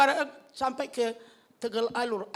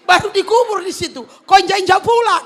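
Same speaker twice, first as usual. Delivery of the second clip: retold angry complaint; 6 semitones higher, 13 dB louder than usual.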